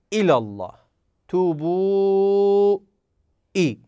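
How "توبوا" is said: توبوا recited with the waw drawn out, higher; Quranic recitation. Shown as a demonstration. Teacher reciting an example of madd for students, chanted. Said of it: "تلاحظون همزة، واو ساكنة".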